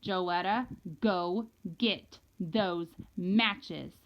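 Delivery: very slow, measured command; menacing order. A narrator's reading. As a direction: space out every word as a warning. Angry mother repeats her order.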